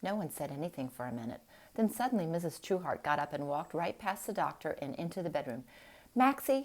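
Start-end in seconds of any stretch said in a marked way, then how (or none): 6.1-6.6 angry shout. Acted. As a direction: shout the name, furious. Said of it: "she shouted angrily".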